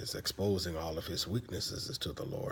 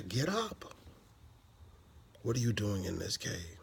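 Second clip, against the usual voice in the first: God's voice. Retold or imitated. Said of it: "the voice says to Elijah".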